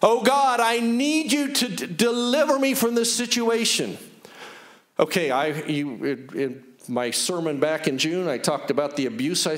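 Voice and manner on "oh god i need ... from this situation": high, pleading prayer demonstration; raised-pitch plea for deliverance; loud cry to God. Shown as a demonstration. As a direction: cry out to God, louder at the start. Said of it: "a loud cry out to God".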